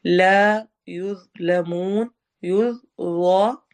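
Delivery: slow chant, long final oon; Quran recitation. Shown as a demonstration. Teacher reciting verse end for students, slow. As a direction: let the oon stretch out. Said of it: "मोटा भी पढ़ेंगे और नरम भी".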